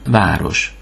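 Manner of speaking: ordinary delivery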